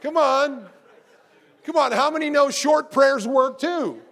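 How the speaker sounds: normal talking voice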